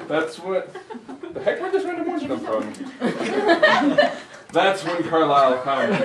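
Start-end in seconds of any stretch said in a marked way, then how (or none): 1.3-2.7 much higher, laughing in surprise; baffled by the script; giggly disbelief; startled laugh. Real, not acted. Surprised by random orange line. 2.8-4.5 squealing laugh; can't stop laughing; high giggle. Real, not acted. Laughs at script.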